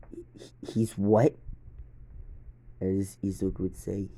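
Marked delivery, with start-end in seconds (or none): 0.1-1.4 shocked disbelief. Acted. Guard shocked at Benji's death.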